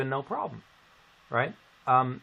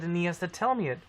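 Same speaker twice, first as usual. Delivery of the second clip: normal talking voice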